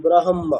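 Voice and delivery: ব্রাহ্ম said as brahom, h before m; spelling-order reading. Shown as a demonstration. Teacher demonstrating the spelling-order reading of ব্রাহ্ম, h before m. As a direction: sound out ব্রাহ্ম with the h first, then m. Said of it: "আগে হ আসবে".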